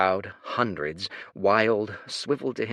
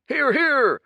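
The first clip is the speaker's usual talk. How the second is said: high, loud "Hear, hear!"; crowd cheer; 8 dB louder than usual. Acted. Crowd members shouting approval, loud and high. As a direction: shout agreement from the throng.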